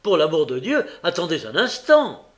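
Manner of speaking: loud, high entreaty; pleading shout from a newcomer; urgent cry. A narrator's reading. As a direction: beg them loudly to wait. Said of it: "s'écria le nouveau venu tout hors d'haleine".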